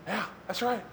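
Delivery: very soft aside in support; muttered agreement. Retold or imitated. Other disciples backing Peter up, muttered softly. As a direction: chime in under your breath.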